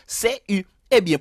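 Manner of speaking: usual speaking voice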